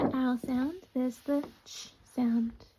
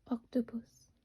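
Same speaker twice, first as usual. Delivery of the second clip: whisper; 6 dB quieter than usual. Shown as a demonstration. Teacher whispers a sound example.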